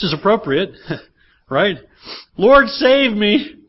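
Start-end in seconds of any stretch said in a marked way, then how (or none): 2.4-3.5 high, loud, slow plea for rescue; desperate cry. Acted. Pastor voices sinking Peter crying out, loud desperate plea. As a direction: cry out like a man going under.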